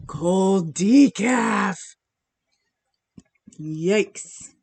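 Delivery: slow, drawn-out disappointed cold decaf; disgusted drawl. Real, not acted. Tasting her cold coffee on stream, slow disappointed drawl. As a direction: stretch it out, unimpressed.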